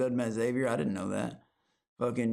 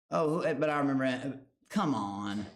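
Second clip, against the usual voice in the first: normal talking voice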